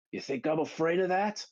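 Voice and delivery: high, rising acted challenge. Johnny's line, building to battle cry.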